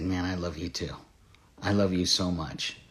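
low, hushed, tender reply; tender father. Retold or imitated. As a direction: speak softly as a dad hugging his little girl. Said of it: "I knelt down and I hugged her".

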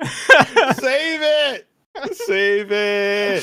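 high, loud excited calls. Real, not acted. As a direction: shout it out with glee. Excited about the clip.

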